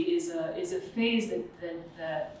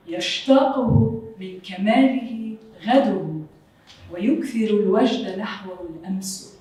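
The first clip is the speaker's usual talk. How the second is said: Arabic poem recital; 3 dB louder, faster than usual. Shown as a demonstration. Lecturer recites Abu Tamam's Arabic verse aloud to audience, measured recital. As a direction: intone the classical line like a reciter. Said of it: "read out loud in Arabic".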